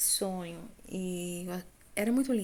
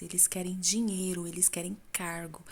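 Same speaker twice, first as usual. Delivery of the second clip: ordinary talk, nothing marked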